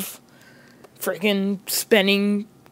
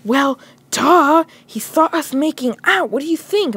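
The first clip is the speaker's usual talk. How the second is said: higher, mocking know-it-all voice; exasperated girlfriend; 7 semitones higher than usual. Girlfriend on the phone to boyfriend, exasperated mimicry. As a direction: toss it off as if obvious. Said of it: "She goes".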